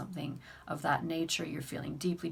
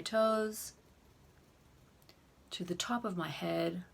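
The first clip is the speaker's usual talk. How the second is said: guided tapping affirmations. Shown as a demonstration. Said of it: "So let's do an example".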